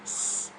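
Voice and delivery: a soft hissing S, unvoiced; hissed s sound. Shown as a demonstration. Student demoing the ending sound for homework, soft hiss. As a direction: hold a quiet S hiss. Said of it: "đọc là S".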